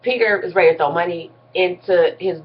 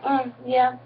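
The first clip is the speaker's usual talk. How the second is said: uninterested; 4 semitones higher, 7 dB quieter than usual. Retold or imitated. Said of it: "really uninterested".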